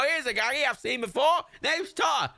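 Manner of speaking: very high, hammed-up Geordie voice; bad Newcastle accent. Shown as a demonstration. Gamer mock-introducing a character called Tar, bad Newcastle accent. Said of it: "can't do a Newcastle accent".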